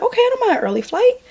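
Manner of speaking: high-pitched, sing-song acceptance; lightly hammed-up carefree reply; breezy mock agreement. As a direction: go high and easygoing, acting out the reply. Acting out carefree reply at airport.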